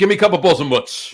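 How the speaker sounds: brash local customer; Jersey deli regular. Acted. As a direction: bark an order for "mutz", vowels dropped. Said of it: "they're gonna ask for the mutz".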